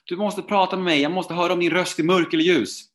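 high, loud, panicked plea; retold frantic shouting. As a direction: imitate yelling desperately at a cashier. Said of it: "skriker till tjejen i kassan så här".

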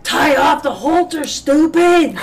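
loud, high, slow yelled order; mock shout. Friends yelling at the rider on the horse, loud. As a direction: bark the order at the newbie. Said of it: "They're like".

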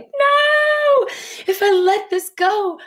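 high, loud frightened cry; panicky inner voice. Acted. Coach voicing the client's fear of letting go, high frightened cry. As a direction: squeal it out like the scared part of you.

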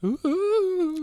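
high, wailing ghost moan. Acted. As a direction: float a long spooky ooh. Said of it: "That was my ghost impression".

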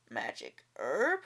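low, slow greedy growl. A narrator's reading. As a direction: growl it, suddenly interested. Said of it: "growled Lai Tong".

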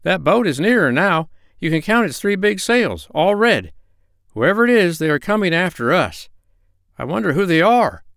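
high, urgent animal character; high-pitched anxious storybook voice; alarmed Dab-Dab. Acted.

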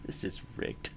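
muttered under the breath; whisper. Acted. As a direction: whisper it as a sulky aside. Joking complaint after losing the argument.

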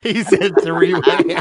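giggly, slow repeat; snickering while he says it; laughing. Real, not acted.

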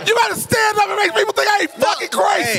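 high-pitched, frantic comic outburst; hammed-up hysterics, very high voice; comic panic. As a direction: plead hysterically to be believed. Panicking over clown nobody admits seeing.

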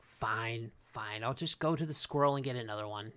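soft, low mock resignation; quiet grudging acceptance; resigned. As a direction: imitate giving in, muttered under the breath. Papa Smurf gives in, retold.

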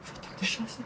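hushed, breathless whispered swear; whispered curse. Acted. Young man hears the police will come to his flat, whispered curse. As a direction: curse under the breath in dismay. Fear of being arrested.

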